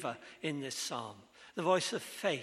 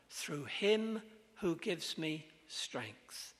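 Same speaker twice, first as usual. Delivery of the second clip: slow emphatic; much slower than usual. Real, not acted. Slow emphatic scripture answer.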